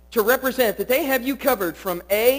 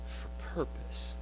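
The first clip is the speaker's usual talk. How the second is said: slow whispered "purpose"; 4 semitones lower, 17 dB quieter than usual. Acted.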